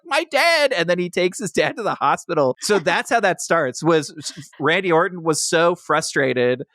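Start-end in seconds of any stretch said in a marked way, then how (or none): 0.1-0.7 very high anguished yelp; mock alarmed cry. John Cena rushing to his beaten dad, a very high mock-anguished cry. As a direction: cry out like a panicked son.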